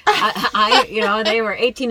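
breaks into a loud laugh mid-sentence. Real, not acted. Mom laughing about her wild little boys, laughing.

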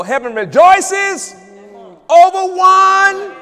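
high, loud, slow shouted praise; full-force preaching. Real, not acted. Preacher shouting praise to the congregation, high and loud. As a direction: lift the church with shouts of hallelujah.

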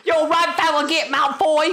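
high, angry British squawk; hammed-up furious British schoolboy; Harry Potter. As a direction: squeal the insult in mock British. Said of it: "You sound like the most angry Harry Potter".

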